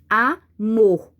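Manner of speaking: Amor split in two, with "mor" loud; stress on the last syllable. Shown as a demonstration. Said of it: "Qual é a sílaba que eu falo com mais forte". (Amor com a última sílaba forte)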